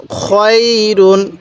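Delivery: খয়রুন with the "khoy" glide held long; drawn-out recitation. Shown as a demonstration. Teacher modelling the word, খয়রুন slow and drawn out. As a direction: stretch খয় slowly, then close on রুন. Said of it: "খাই পড়বেন না".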